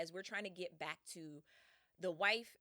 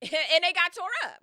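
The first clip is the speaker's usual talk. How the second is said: high, loud exclamation out of a laugh; laughing loud repeat; 8 semitones higher, 14 dB louder than usual. Real, not acted. Storyteller relishes the crew's fate, loud and laughing. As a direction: belt it out, amused.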